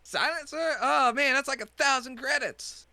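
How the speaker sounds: high, loud, outraged haggler; pushy shopkeeper. Acted. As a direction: defend the steep price, loudly. Shopkeeper NPC voice.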